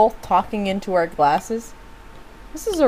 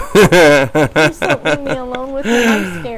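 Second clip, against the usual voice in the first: laughing plea; 4 dB louder, slower than usual. Acted. Repeats plea, slow laughing.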